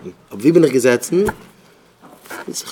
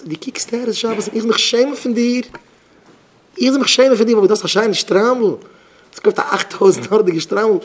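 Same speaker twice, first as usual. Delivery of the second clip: high-pitched, raised-voice scolding; indignant reproach; 7 semitones higher, 4 dB louder, faster than usual. Acted. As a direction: act out an affronted telling-off. Acted reproach about shame and shtreimel. (אויפגעבראכטער פארוואורף)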